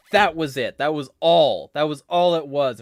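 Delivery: loud, punched short phrases; angry insistence. Real, not acted. Dismissing the excuse for intervention, angry insistence. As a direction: hammer each phrase home angrily.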